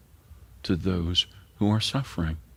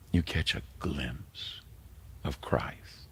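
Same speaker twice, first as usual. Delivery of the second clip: reverent hush; 4 semitones lower, 9 dB quieter, slower than usual. Acted. Sermon climax, reverent hush.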